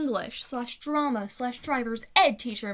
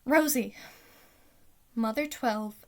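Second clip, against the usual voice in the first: ordinary talk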